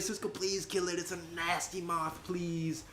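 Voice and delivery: high whiny begging; mock-whimpering plea; whiny pleading. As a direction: beg him in a put-on whine. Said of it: "I'd be like".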